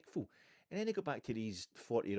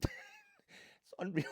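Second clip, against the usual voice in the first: laughing; much slower than usual. Real, not acted. Laughing at the scam.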